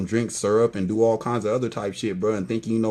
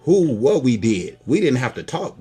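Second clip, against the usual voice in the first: ordinary talk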